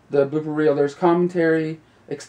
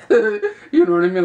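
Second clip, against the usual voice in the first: laughing; 5 dB louder than usual. Real, not acted. Laughing after mock reaction.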